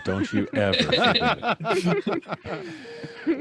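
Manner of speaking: high, slow hammed-up boss voice, laughing; mock bossy boast. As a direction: lord it over them in jest, drawn out.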